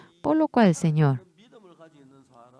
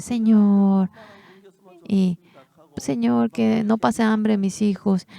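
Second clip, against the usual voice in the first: pleading prayer; 5 semitones higher, 3 dB louder, slower than usual. Pretend. Desperate prayer of poor parent.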